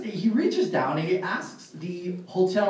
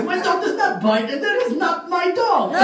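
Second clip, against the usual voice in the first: much higher-pitched comic clerk voice; hotel clerk; 10 semitones higher than usual. Acted. Clerk answers the bitten inspector, high emphatic punchline. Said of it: "And the guy goes".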